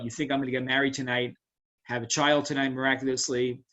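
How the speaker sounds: normal talking voice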